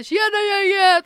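loud, high, bubbly hello to the group; cheery greeting. Retold or imitated. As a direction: burst in beaming at everyone. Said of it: "fullt påklädd och bara". (glatt, högt och överdrivet hej till gänget)

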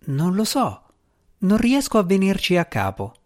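fretful little djinn, unsure; nervous djinn cat. Acted. Narratore dà voce al gatto-djinn. (gatto djinn inquieto, voce più alta)

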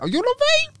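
high squeaky mock surprise; mock surprised. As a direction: squeal it in fake shock, way up high. Mock surprised reply to love confession.

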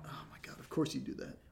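unvoiced whisper of disbelief; whispered exasperation. Real, not acted. Friend whispers in disbelief at the steak purchase, exasperated. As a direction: breathe it out in disbelief.

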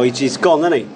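ordinary delivery

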